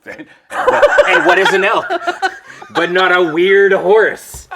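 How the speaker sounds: loud laughing, high and slow; laughing hard. Real, not acted. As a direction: crack up while talking. Laughing about the elk.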